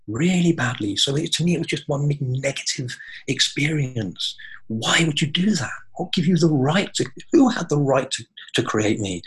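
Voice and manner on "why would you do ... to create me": raised, higher, louder indignant outburst; furious questions hurled at the creator; angry at creation. Real, not acted. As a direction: demand an answer, voice rising in anger. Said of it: "So I've always been angry".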